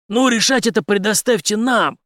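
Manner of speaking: high, forceful reply; sharp retort. A narrator's reading. Henry answering the major's taunt, sharp. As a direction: cut back at the prisoner firmly. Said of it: "резко ответил Генри".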